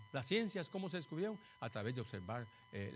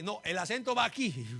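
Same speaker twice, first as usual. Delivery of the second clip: louder, higher insistent correction; correcting Hebrew teacher; 7 semitones higher, 9 dB louder than usual. Acted. Hebrew speaker correcting where the accent goes, insistent correction. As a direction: correct the pupil emphatically, pitched up. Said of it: "uno se hace un hebreo y le dice".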